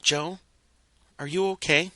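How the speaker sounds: tender concern for a sad friend; soft filly voice. A narrator's reading. Filly gently asking Joe if he is okay. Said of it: "lifted the soft voice of the filly".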